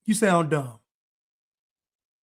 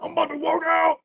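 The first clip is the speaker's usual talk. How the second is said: much higher mocking whine; mocking the walkout man; 9 semitones higher than usual. Mocking the guest who threatened to walk out, high whine. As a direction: parody the man threatening to leave.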